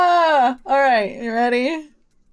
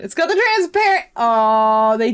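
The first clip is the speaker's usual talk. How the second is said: indignant realization; 4 dB louder than usual. Real, not acted. Player spotting the transparent background trick, indignant. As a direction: voice jumps up, caught out.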